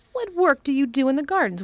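lightly voiced imperious boy; lordly boy. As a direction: question him like a little rajah. Colin the Rajah questioning.

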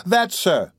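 a touch of alarm, pointing it out; alarmed cry. A narrator's reading. Oliver points from the carriage window, crying out. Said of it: "cried Oliver".

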